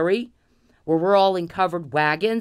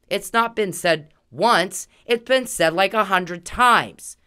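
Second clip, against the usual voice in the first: slow, punched stress on not and been, rising louder; emphatic stress; 3 semitones higher, 5 dB louder, slower than usual. Real, not acted. Angry vlogger hammering home to the harasser, slow and emphatic. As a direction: hammer each word of the correction.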